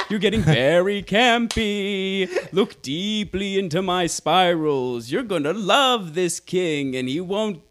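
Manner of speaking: slow, high, hypnotic fake British voice; mock British accent. Co-host hams a British hypnotist, slow and high. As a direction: lull them like a campy hypnotist in British. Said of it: "give this British accent a try".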